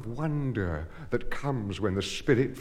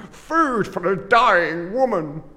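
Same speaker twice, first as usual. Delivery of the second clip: high, loud, slow desperate cry; 7 semitones higher, 6 dB louder than usual. Acted. Husband begs at the workhouse gate, loud slow cry. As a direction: call out for help at the gate.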